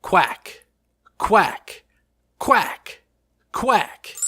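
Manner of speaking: quacking like a cartoon duck. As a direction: give four short duck quacks. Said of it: "I have one thing to say".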